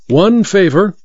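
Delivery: lightly voiced masked magician; courteous masked magician. A narrator's reading. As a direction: ask it lightly, like a showman.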